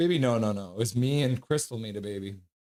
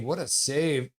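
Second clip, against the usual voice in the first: normal talking voice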